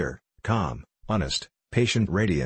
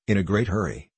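usual speaking voice

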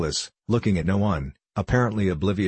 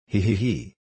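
put-on a short jeering cackle at the drunk; mocking guffaw. A narrator's reading. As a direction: laugh it out in mockery. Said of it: "went off into a guffaw".

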